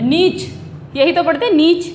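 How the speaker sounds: "नीच" with long ee and final ch; "नीच" the everyday reading, long vowel; common mispronunciation. Shown as a demonstration. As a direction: say "नीच" as neech. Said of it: "क्या पढ़ते हैं हम common में".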